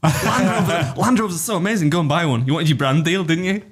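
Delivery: mock influencer ad over Land Rovers; mock advert read. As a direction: sell it like a cheesy sponsor. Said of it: "Stephen Bartlett ad".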